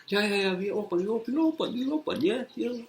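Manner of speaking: put-on high-pitched broken-English Chinese accent; squeaky imitation of a Chinese receptionist. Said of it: "I can't do the fucking Chinese accent".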